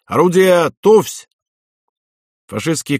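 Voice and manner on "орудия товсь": high, loud order to the guns; drawn-up military command, acted out; commanding shout. A narrator's reading. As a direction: shout the gun crews to readiness. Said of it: "скомандовал вахтанг".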